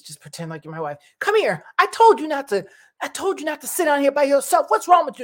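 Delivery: a stranger faking a scolding husband to save her; scolding husband act. Retold or imitated. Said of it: "the guy comes up and he goes".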